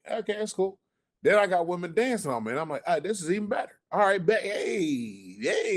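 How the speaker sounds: imitating his own delight. His delight on the dance floor.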